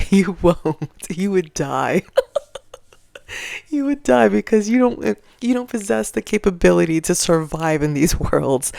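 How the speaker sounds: laughing through the words. Real, not acted. Vlogger taunting fans while laughing. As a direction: giggle out the taunt.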